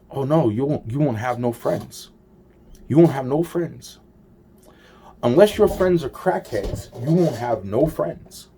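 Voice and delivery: a hint of a scornful lecturer; mock taunting voice. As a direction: taunt it like someone scolding you. Mocking someone's warning, retold.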